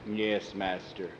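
low, soft, slow monster voice; obedient servant. Acted. Thor yielding to his master, obedient. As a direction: give in slowly, quietly.

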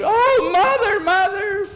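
very high, squealed scream. A narrator's reading. Nancy cries to her mother after the cake explodes, screaming. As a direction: shriek for help in a very high, loud voice. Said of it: "Nancy screamed".

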